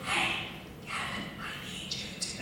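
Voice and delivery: breathy voiceless whisper, very soft; imitating a lost voice. Coworker with no voice whispering a request, voiceless whisper. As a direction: mimic a coworker who can only whisper. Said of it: "she lost her voice".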